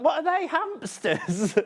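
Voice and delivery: high, drawn-out question breaking into laughter; amused mock puzzlement. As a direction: play baffled at yourself, laughing. Retells own silly mistake, laughing.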